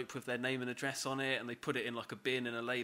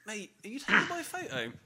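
higher-pitched retold confrontation; mock challenge; 8 semitones higher, 4 dB louder than usual. Retelling confronting the man with the phone, indignantly. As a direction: challenge a stranger, lightly acted.

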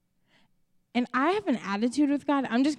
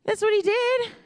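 ordinary delivery

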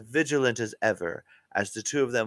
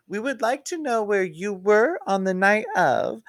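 lightly voiced Wiener; 10 semitones higher, 4 dB louder than usual. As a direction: go up high as the eager trainee. Wiener's high voice.

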